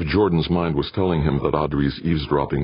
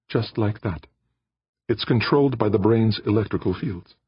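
higher, lighter female voice for Aditi; Aditi's voice; 7 semitones higher than usual. A narrator's reading. Aditi answers Jordan in her higher voice.

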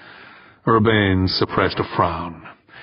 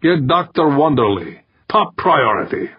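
raised, higher-pitched command to the phone; calling out; 6 semitones higher than usual. A narrator's reading. Timoshenko orders the phone to get Wonderly, calling out. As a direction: call out an urgent order in character. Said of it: "he called out".

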